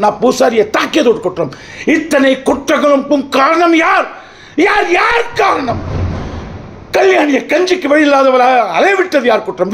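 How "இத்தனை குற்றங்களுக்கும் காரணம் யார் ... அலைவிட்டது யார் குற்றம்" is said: high, ringing rhetorical demand; accusing shout. Acted. Accused man demanding the court name the culprit, accusing shout.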